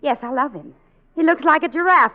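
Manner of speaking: normal talking voice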